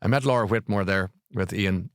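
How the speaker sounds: ordinary delivery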